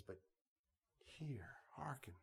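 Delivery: hushed, breathy call to hear and listen; hushed plea. Acted. Preacher voicing God to his people, whispered plea. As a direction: let it drop to a near whisper.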